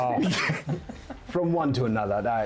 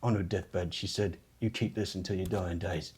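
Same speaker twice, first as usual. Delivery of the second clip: quiet sentimental lie; 5 semitones lower, 6 dB quieter than usual. Acted. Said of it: "And he says".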